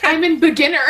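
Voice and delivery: laughing through a teasing mock quote. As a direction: giggle out the words while teasing a friend. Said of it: "You're like".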